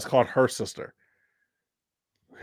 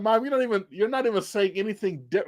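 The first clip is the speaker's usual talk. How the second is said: higher-pitched retold protest; playful, pitched-up complaint; mock exasperation; 7 semitones higher, faster than usual. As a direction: object like a fed-up child.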